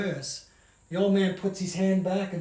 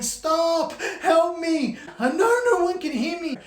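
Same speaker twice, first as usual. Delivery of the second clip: desperate yelling; 8 semitones higher than usual. Acted. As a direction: shout for help into the dark night road. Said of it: "She runs up to the road yelling".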